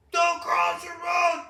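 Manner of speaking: high, loud, slow yelled order; hammed-up shouting sheriff; mock scream. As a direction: scream it like the angry cop. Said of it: "screaming about".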